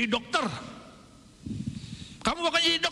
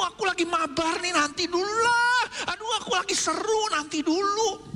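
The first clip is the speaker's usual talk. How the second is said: put-on much higher, whining child voice; whiny gamer kid; 11 semitones higher than usual. Kid in a game refusing mom, high whiny protest. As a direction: whine like a kid who won't stop playing.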